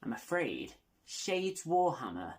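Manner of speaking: ordinary talk, nothing marked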